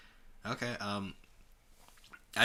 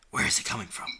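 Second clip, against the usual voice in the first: whisper; much faster than usual. Acted. Reading character's thought.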